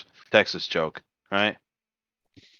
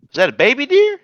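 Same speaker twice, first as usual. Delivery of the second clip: high-pitched doubting question; incredulous Texan; 4 dB louder than usual. Retold or imitated. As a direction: scoff in mock disbelief. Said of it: "Texas goes".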